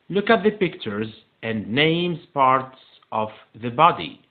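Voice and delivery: slow, drawn-out English instruction; slow model reading. Shown as a demonstration. Teacher reading a new exercise to pupils, slow model reading. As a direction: read the task out slowly.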